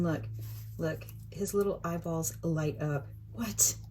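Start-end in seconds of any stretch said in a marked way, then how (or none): 3.3-3.8 a startled whisper; whispered what. Real, not acted. Whispering in surprise as the frog's eyes light up. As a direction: breathe the word out, startled.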